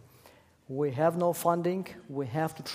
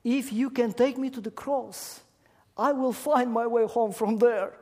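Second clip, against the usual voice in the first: put-on high, bright-eyed child voice, louder; high girl voice, sure of the way home; hopeful little girl; 7 semitones higher, 4 dB louder than usual. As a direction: answer like a little girl who just found hope. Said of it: "with smile on her face".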